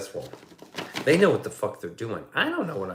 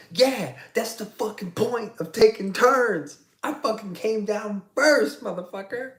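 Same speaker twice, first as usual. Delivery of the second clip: retold furious yelling; 11 semitones higher, 4 dB louder than usual. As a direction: yell back at the kid in mock fury. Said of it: "I was fucking still yelling".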